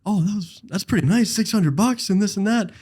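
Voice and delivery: mocking coworker voice. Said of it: "and he goes".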